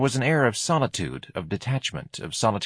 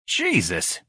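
understated sneer in character. A narrator's reading. George greets Ignatius outside the cinema, sneering. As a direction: let out a contemptuous oath. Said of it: "he sneered".

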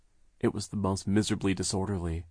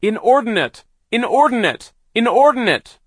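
high, very loud shout; 15 dB louder than usual. A narrator's reading. Sister shouts over the saw to free brother, full shout. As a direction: yell it over the noise. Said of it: "as loudly as she could to be heard".

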